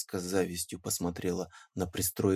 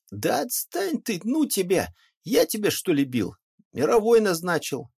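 high, impatient peasant; brushing him off; 10 semitones higher, 5 dB louder than usual. Acted. Peasant fending off Pakhom's complaints: high, exasperated. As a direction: get away from me, exasperated.

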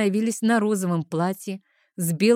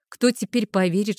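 ordinary delivery, nothing marked

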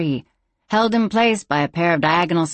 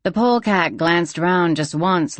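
usual speaking voice

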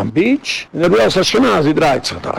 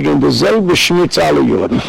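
usual speaking voice

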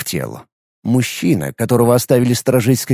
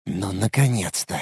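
low, soft grumble under the breath; soft, low mutter; 4 semitones lower, 9 dB quieter than usual. A narrator's reading. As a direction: mutter it quietly to yourself. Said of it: "пробормотал скрипач".

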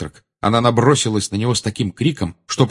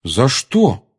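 louder, higher indignant question; angry; 4 semitones higher, 5 dB louder than usual. A narrator's reading. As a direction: protest with a burst of anger. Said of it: "сердито спросил Гарри". (сердитый, возмущённый вопрос)

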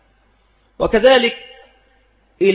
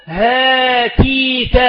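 هاتيك drawn out very slowly; slow dictation; 4 semitones higher, much slower than usual. Shown as a demonstration. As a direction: say هاتيك slowly to show the kept alif. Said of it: "فتكتب ها هنا بإثبات الألف". (نطق هاتيك ببطء واضح)

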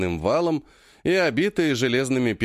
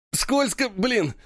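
complaining; 10 semitones higher, much slower than usual. A narrator's reading. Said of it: "жалуется один".

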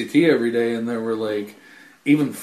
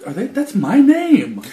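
slow, high mock wonder; 11 semitones higher, 3 dB louder than usual. Voicing CM Punk hearing chants.

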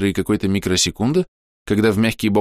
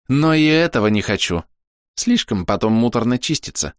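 a hint of a cocky troll, higher; lightly voiced troll; 7 semitones higher than usual. A narrator's reading. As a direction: calmly lecture the attackers as a troll. (слегка сыгранный тролль)

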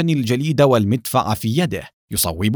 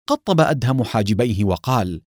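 plain reading voice

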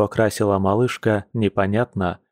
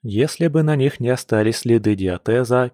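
plain reading voice

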